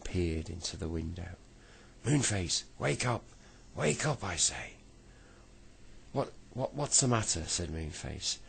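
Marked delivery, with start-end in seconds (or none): 2.0-4.7 high, loud angry call; shouting. Acted. Angry pounding on door. 6.1-7.4 high, startled sleepy voice; just woken, groggy and confused. Acted. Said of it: "in a sleepy voice".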